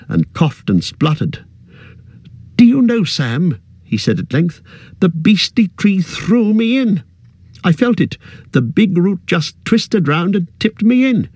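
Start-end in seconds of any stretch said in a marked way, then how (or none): 2.5-3.6 Frodo voice. A narrator's reading. 5.0-11.3 Frodo voice. A narrator's reading.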